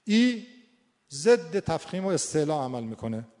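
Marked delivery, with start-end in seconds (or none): 0.0-0.5 sustained loud «ای» as the front ee vowel; demonstrated ee vowel. Shown as a demonstration. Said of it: "دیگه، ای". («ای» کشیده و بلند)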